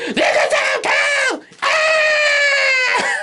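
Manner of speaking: overexcited friend yelling way up high; hyped up. Retold or imitated. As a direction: squeal it out like she's totally pumped. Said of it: "she gets hyped up easily".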